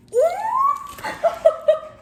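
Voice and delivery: very high, loud laughing squeal; delighted squeal. Real, not acted. Vlogger seeing what Sid got them, squealing. Opening the gift.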